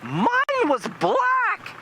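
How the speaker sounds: very high, drawn-out screech; imitated aghast kid, hammed-up and high; mock horrified son. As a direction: stretch it slowly in shrieking falsetto. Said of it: "And her son says".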